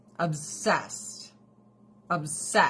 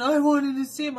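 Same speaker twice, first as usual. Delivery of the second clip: high, loud mock whine; whiny husband; 9 semitones higher, 7 dB louder than usual. Husband whining about cat time, high mock whine. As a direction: whine like a jealous kid.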